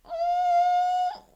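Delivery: a suspicious drawn-out mm; skeptical hum. Retold or imitated. Host side-eyeing the contestant.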